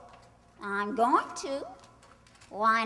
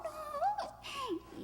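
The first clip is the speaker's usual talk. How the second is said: much higher, laughing no; 10 dB quieter than usual. Acted. Tracy laughs off the ringing phone to Mike, high laughing.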